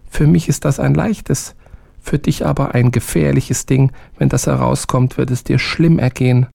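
high, small servant voice with a warning; small helper cautions his master, high. A narrator's reading. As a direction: pitch up, caution the soldier gently. Held little man voice.

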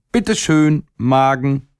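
put-on high, teasing call from a cheeky little boy; esclamazione del ragazzino burlone. A narrator's reading. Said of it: "rief er".

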